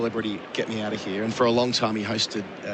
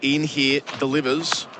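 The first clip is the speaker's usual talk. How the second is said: raised, measured cricket commentary; live play-by-play; 4 semitones higher than usual. Real, not acted. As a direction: call the ball live as the bowler runs in.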